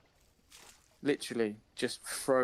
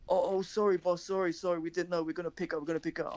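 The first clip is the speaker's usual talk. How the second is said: mock sorry; 5 semitones higher than usual. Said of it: "they're literally like".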